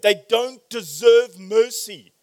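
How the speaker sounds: ordinary delivery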